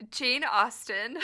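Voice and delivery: Jane Austen through laughter; can't keep a straight face; laughing. Real, not acted. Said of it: "I can't even keep a straight face".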